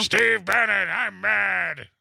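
hammed-up furious Bannon rant; mock angry Bannon. Mocking Bannon, angry shout.

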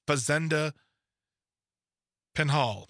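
halting sound-it-out reading with a long pause; slow, halting. Real, not acted. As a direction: sound out the foreign name word by word. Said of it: "Just say it really fast".

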